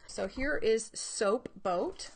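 normal talking voice

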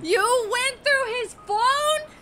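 high, slow, stunned; outraged disbelief. Real, not acted. [name] stunned that Rami read Lucas's phone. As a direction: drag it out in shock. Outraged at friend reading texts.

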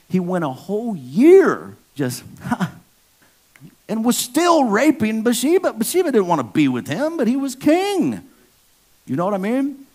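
high, loud, outraged sermon voice; fired-up preaching. Real, not acted. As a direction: hammer the sin home to the congregation. Outraged preaching about King David.

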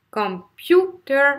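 "computer" slowly broken up, PU punched high and loud; stress on second syllable. Shown as a demonstration. Teacher showing the accented syllable, exaggerated com-PU-ter. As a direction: lean hard on PU in "computer". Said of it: "là c'est la deuxième syllabe".

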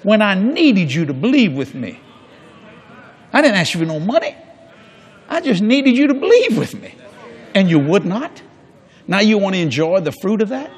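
ordinary delivery

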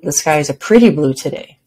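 "pretty" lifted above the other words; emphasize "pretty" to test how it sounds; stress on pretty. Shown as a demonstration. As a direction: punch the word "pretty". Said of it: "with emphasis on a different word each time".

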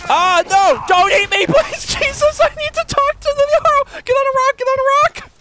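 high, loud frantic yelling; panicked shout. Real, not acted. Streamer fleeing a dragon, high frantic shouting. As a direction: scream for help, laughing in terror.

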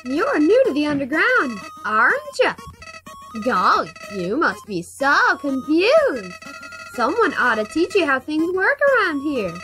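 slow friendly flower voice; lightly voiced cheerful Flowey. Reads Flowey's dialogue.

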